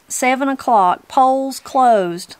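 a hint of a town official calling time; formal announcement. A narrator's reading. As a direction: announce the poll closing. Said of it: "pronounced Tecumseh Hewitt".

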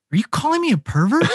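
mock-indignant at the manager; indignant challenge. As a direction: throw the accusation back. Confronting manager in retold quarrel.

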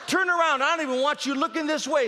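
high, loud scolding dad; stern dad. Retold or imitated. Dad scolding son in the back window, loud. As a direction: bark the order like a fed-up father.

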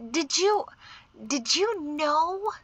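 high, halting, careful question; tactful hesitation. A narrator's reading. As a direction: feel your way delicately into the question. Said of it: "she searched for a tactful way to put it".

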